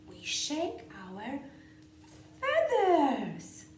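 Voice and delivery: lively, sing-song picture-book read-aloud with whispered bits; reading to children. A narrator's reading.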